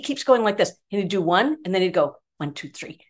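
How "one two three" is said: very soft, low count; hushed count. Retold or imitated. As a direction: count it off under your breath. Retold silent count during broadcast.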